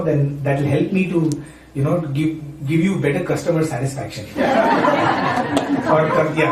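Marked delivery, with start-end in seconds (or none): 4.3-5.8 a short airy laugh at his own joke; breathy laugh. Real, not acted. Laughing after joking about customer satisfaction. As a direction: laugh it off lightly.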